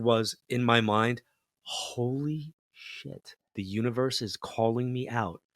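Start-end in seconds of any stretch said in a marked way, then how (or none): 1.6-5.4 hushed, very slow, higher "Holy shit"; a drawn-out stunned thought to himself; realizing in a meeting, slow hushed awe; stunned inner voice. Retold or imitated.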